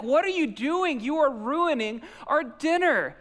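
high, loud exasperated inner rant; spinning internally. Retold or imitated. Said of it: "I was spinning internally".

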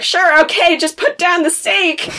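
high, anxious go-along; a nervous man at stakepoint; nervous placating. Retold or imitated. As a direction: humor the guy holding the stake, pitch up. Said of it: "the man's like".

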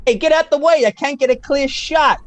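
loud, annoyed call; shouting. Acted. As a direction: yell at them to move. Filmmaker yells to move.

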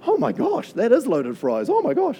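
high, excited gasp at the fries; mock amazement. Customers amazed at the huge loaded fries, squealing. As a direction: ham up the wide-eyed oh my gosh.

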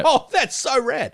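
high, slow surfer drawl; surfer dude voice. Retold or imitated. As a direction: gush like a laid-back surfer. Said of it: "I was like".

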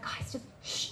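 whispered hush to the killers; urgent whisper. Retold or imitated. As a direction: shush them in a panic. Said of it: "you freak out with them".

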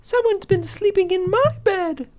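tiny little squeak, very high; very high storybook cub voice; Baby Bear. A narrator's reading. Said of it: "squeaked in his tiny little voice".

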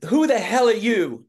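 loud, high, drawn-out Southern outburst; Southern accent. Retold or imitated. Southern man confronts the new page answering phones, imitated Southern accent. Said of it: "man with a Southern accent who talked like this".

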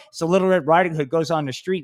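ordinary delivery

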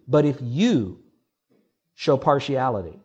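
"you" pressed hard and set apart; stress on YOU. Shown as a demonstration. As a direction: lean into "you" so it lands on the listener. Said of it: "emphasizing the word you".

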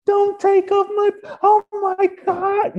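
high, loud hammed-up shriek, slowing at the end; mock panic scream. Someone refusing to take off the blue shirt, mock panic scream. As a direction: shriek like someone losing their own skin. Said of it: "You don't start screaming like".

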